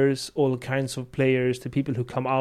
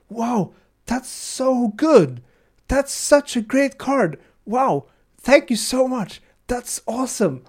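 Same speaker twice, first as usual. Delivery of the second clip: put-on much higher, slow, gushing kid voice; delighted kid voice; 12 semitones higher, 7 dB louder, slower than usual. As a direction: gush like a thrilled child over a new card. Imitating delighted kid at prerelease.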